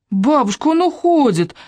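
put-on high excited child's cry; girl calling out. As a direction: shout to grandma in alarm. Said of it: "крикнула Варя".

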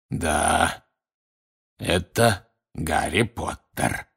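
slow, croaking voice from behind; drawn-out hoarse growl. A narrator's reading. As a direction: rasp it out slowly. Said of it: "прохрипел кто-то сзади".

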